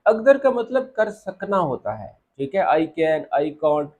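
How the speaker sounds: usual speaking voice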